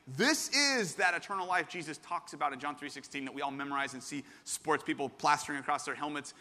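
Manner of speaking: usual speaking voice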